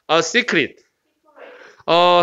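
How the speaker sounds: ordinary talk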